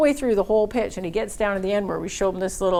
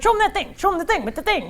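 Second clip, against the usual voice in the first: excited urging; 11 semitones higher, faster than usual. Retold or imitated. Banker urging reps to demo, very high and excited. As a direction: bounce with impatience.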